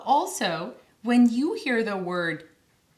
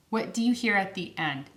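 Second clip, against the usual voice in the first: normal talking voice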